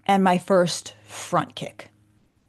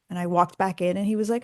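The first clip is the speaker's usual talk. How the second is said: normal talking voice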